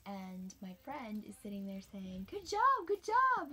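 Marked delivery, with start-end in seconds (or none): 2.3-3.5 higher, louder cheer; cheering finishers. Retold or imitated. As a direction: cheer each runner coming in. Friend cheering finishers at tent.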